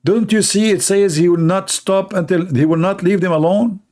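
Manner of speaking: ordinary talk, nothing marked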